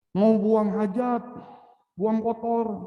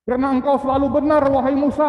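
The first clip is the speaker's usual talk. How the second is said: loud, higher and fast mocking praise; mocking Samiri; 7 semitones higher, 8 dB louder, faster than usual. Samiri mocking Musa's righteousness, loud and high. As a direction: throw the flattery out loud and quick. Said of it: "Apa kata Samiri?".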